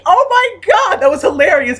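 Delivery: very high, gleeful; delighted high-pitched squeal. Retold or imitated. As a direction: squeal it with glee. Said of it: "I was like".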